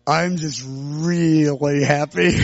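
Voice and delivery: very slow, low, blissful reply; happy, not giddy. Retold or imitated. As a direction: draw it out with a contented smile. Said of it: "I don't mean giddy".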